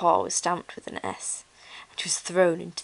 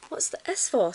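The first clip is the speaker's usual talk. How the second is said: high, inquisitive piggy squeal; 6 semitones higher than usual. A narrator's reading. Toy pigs staring at Ruby's paw, squeal. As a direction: squeal the question lightly. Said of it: "squealed the pigs".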